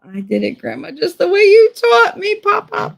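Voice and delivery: put-on high, loud, proud little-kid voice; a hammed-up, triumphant kid's squeal; gleeful kid quote. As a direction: squeal it like a proud child showing off.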